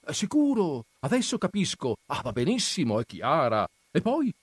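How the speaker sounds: high, chirpy show of attention; feigned interest. A narrator's reading. Agnese humouring Perpetua to keep her chattering, feigned interest. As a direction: egg the chatterer on, pitch jumping up. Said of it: "per far vedere che stava attenta o per ravvivare il cicalio".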